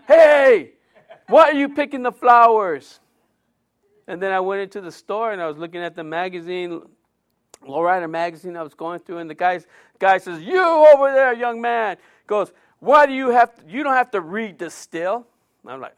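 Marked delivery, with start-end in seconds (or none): 0.1-3.0 high, loud scolding call; scolding shout. Retold or imitated. Man catches young preacher picking roses, loud scolding shout. 10.4-12.0 high, loud call across the store. Retold or imitated. Store clerk calls out to the boy reading magazines, loud. 12.8-15.3 scolding clerk. Retold or imitated. Clerk scolds magazine reader.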